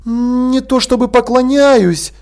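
high, slow stretched-out hedging; drawled, uneasy. A narrator's reading. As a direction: draw it out with some awkwardness. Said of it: "протянул Тепик".

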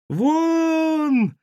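very high sustained shriek; piercing high scream; shrill squeal. Acted. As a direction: squeal it out and hold the vowel. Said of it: "визжали из подвала".

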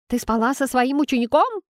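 shocked friend blurting a question; высоким голосом, выпалить в шоке. Acted. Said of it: "выпалила Уитни".

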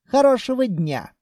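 high, sweetly polite farewell; exaggerated courtesy. A narrator's reading. Buyer bids rude Khalya farewell, brightly over-polite. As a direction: say it with an amiable smile. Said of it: "как можно любезнее".

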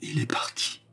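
near-whispered, mournful despair; lugubre et navré. A narrator's reading. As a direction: let it out as a stricken whisper. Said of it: "résonnèrent lugubres et navrés". (murmure lugubre et navré)

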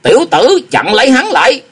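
very high, loud eerie command to stop him; old master shrieking at the youth to block the fleeing beggar; weird shriek. A narrator's reading. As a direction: screech the order out, strange and sudden. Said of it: "la lên một tiếng quái gở".

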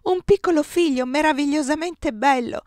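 a touch of happy sobbing in the voice. A narrator's reading. Giulia tells Giambattista of his son, tearful and joyful. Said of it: "singhiozza Giulia".

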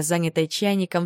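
ordinary delivery, nothing marked

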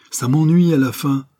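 loud, high, fed-up cry; cried out. A narrator's reading. Prisoner cries out at the judge, loud and fed up. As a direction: shout out your exasperation. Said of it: "cria le malheureux".

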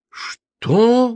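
high, stunned gasp of a word; shocked exhale; breathed out. A narrator's reading. Host gasps in disbelief at his tenant. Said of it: "выдохнул Арчи".

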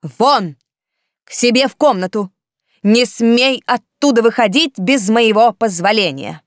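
loud, every word hammered out; booming declamation. Acted. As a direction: declaim it like an emperor. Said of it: "чеканя каждое слово, громогласно произнесла".

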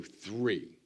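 a single flat final three; just three. Shown as a demonstration. Father tells the congregation he skips the count. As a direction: go straight to three. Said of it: "I just go".

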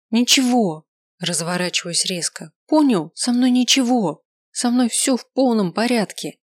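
shouting. Acted. Snaps at him, denying tears.

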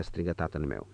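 ordinary delivery

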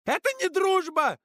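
very high, drawn-out scream; high loud cry, words stretched; shouting. A narrator's reading. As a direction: scream the objection in outrage. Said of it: "закричала она".

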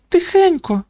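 put-on very high girlish shushing; hushing girl. A narrator's reading. Girl hushing her confused friend, high-voiced. Kalisha hushes the waking girl.

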